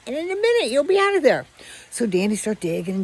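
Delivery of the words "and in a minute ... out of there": high, eager promise. Retold or imitated. Stray dogs coaxing the dog out under the fence, high and eager. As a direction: lift it way up, tempting.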